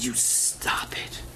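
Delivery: slow, breathy whispered scolding; whispered rebuke. Retold or imitated. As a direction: hush him sternly under the breath. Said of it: "She said".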